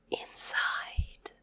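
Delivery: whispered echo of "inside". Shown as a demonstration. Teacher whispering the word back for kids. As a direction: say "inside" on breath only.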